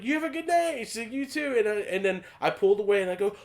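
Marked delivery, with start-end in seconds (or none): 0.0-1.6 sweet polite goodbye. Retold or imitated.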